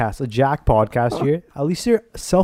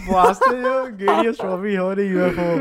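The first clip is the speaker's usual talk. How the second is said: squeaky high-pitched mocking voice; 8 semitones higher than usual. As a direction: pitch it way up, teasing. Teasing high voice continues.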